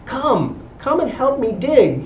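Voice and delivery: high, pleading call; begging. A narrator's reading. Dog begging his friends to help dig, high pleading. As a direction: beg the others to come dig. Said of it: "he begged the other dogs".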